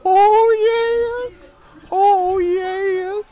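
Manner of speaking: very high, slow, eerie manager wail; slow, eerie falsetto cackle of a spooky manager; Paul Bearer. Retold or imitated. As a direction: moan it out like a ghoulish wrestling manager. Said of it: "throw out a little Paul Bearer for everybody".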